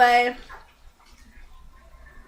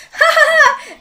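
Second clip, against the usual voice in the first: very high, loud mock cackle; hammed-up villainous ha-ha-ha; 8 dB louder than usual. Egotistic ninja after threatening everyone, mock gloating laugh.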